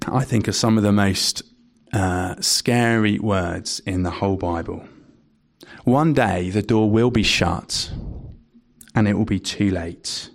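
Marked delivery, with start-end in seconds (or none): none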